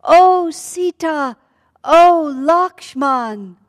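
long drawn-out, high, loud call; loud cry. Acted. Dying demon imitating Rama calls for help, loud cry. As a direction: cry out at full voice, stretching the names. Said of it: "cries out very loud, loudly".

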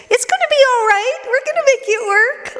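very high, squeaky, anxious reassurance; a tiny panicked squeak trying to sound calm; high, squeaky mock reassurance; squeaky little throat sound. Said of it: "this little sound that came out of my throat".

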